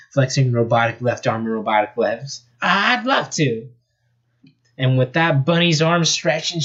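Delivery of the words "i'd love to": high, drawn-out southern drawl; slow, high southern twang; thick southern accent. Acted. Rabbit gladly joins the fight, southern accent. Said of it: "Her voice was a thick southern accent".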